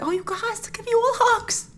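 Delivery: hammed-up tender promise of hugs; mock tender. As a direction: comfort them like a doting mom. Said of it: "I was like".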